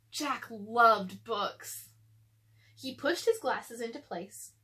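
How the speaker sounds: usual speaking voice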